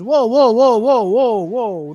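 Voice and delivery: loud, high run of whoas; halting someone mid-thanks, hammed up; mock objection. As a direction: cut in loudly to object, playfully acted. Acted interruption of the thanks.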